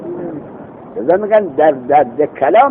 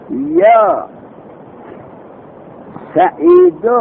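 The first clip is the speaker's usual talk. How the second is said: calling out; 11 semitones higher, much slower than usual. Shown as a demonstration. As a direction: call out the name across a room. Calls out the example vocative.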